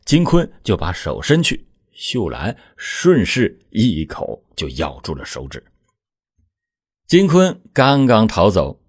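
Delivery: ordinary delivery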